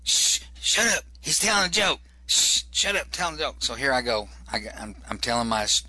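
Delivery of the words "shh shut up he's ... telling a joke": high, hushing imitation of crowd members; mock audience shushes in a higher voice; audience shushing. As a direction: shush your neighbours urgently, pitched up. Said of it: "I could hear people going".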